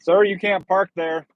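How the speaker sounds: loud, slow call to a driver. Real, not acted. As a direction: shout it across the lot. Calling out at a parked driver.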